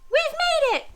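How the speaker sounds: very high, loud cheer. Acted. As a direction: shout for joy. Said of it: "cheered the pirate".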